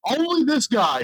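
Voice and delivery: high, animated imitation of exclaiming; Joey. Customer razzing the podcaster to a coworker, imitated as high, animated exclaiming. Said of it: "He goes".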